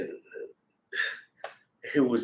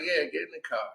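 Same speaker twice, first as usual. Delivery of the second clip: quiet echo; 4 semitones higher, 13 dB quieter than usual. Retold or imitated. Driver backing the girl's order, hushed echo. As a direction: back up the order under your breath.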